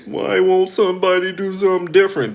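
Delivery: mock complaining self-talk, rising high; whiny inner voice. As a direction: imitate the excuse-making listener. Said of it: "And then you tell yourself".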